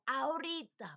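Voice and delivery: high, loud playful mimicry; imitating Bolivian in-laws. Learner playfully imitating her husband's family, exaggerated mimicry. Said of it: "and imitate them".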